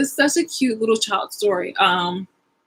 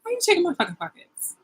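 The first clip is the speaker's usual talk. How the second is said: indignant quote; 11 semitones higher, faster than usual. Retold or imitated. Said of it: "I'm just like".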